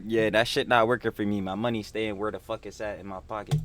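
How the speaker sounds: ordinary talk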